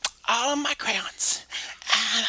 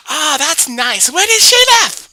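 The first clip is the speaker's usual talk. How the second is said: Mary voice; 5 semitones higher, 12 dB louder, slower than usual. Acted. Mary answers Jimmy, sweet.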